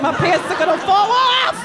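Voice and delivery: hammed-up flustered cry; mock panic. Comic too tense telling the story, mock-panicked. Acted panic while retelling.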